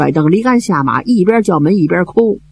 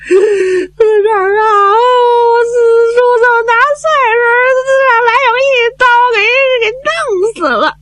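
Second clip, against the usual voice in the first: crying report; faster than usual. Acted. Messenger reports uncle's death, crying.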